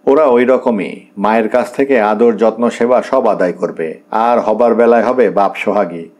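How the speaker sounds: ordinary delivery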